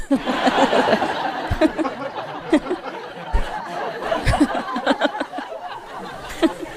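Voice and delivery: long breathless wheezing laugh. Real, not acted. Teller laughs long at the car horn joke.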